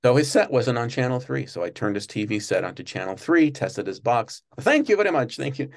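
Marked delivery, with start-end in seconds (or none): 4.6-5.7 higher-pitched imitation of a grateful guest; mock-grateful voice that starts high, then eases down; opera star's thanks. Telling how the tenor thanked him, high imitated thanks.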